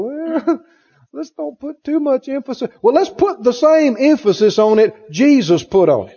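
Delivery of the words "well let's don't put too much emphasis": higher, softer, with a laugh; mocking timid voice. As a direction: tease the hesitant with a laugh. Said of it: "you feel".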